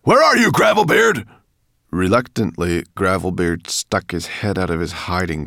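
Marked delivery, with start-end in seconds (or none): 0.0-1.3 high, very loud monster shout; bellowed summons. A narrator's reading. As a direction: bellow for a missing servant. Monster bellows for his servant.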